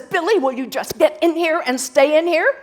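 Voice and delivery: punchline shouted in comic impatience; exasperated Saint Peter. Acted. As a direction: holler at the boy to get inside, mock-exasperated. Saint Peter scolding Billy in joke.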